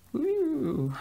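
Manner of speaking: high, bright cheer; excited whoop. Real, not acted. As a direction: let out a happy woo. Cheering herself on.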